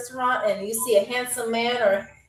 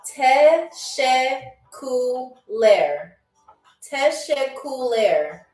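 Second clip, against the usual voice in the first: "teşekkürler" drawn out syllable by syllable; very slowly; slower than usual. Shown as a demonstration. Host giving players the Turkish word to guess, very slowly. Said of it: "I'm gonna say it very slowly for you".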